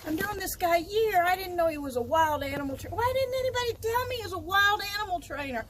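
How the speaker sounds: thrilled what-no-way inner voice; amazed excitement. Retold or imitated. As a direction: burst with disbelief and delight. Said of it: "And I'm thinking".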